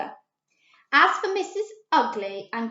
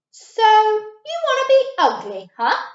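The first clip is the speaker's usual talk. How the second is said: wolf; 10 semitones higher, 9 dB louder than usual. A narrator's reading. Wolf voice in read story.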